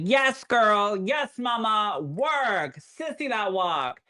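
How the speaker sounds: put-on high, drawn-out "yes, girl" hype; sassy hype cheer. Therapist cheering him on as he walks down the hall, sassy hype.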